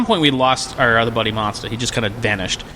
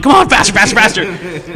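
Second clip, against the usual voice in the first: frantic urging; 8 semitones higher, 7 dB louder than usual. Retold or imitated. Frantic elevator button mashing.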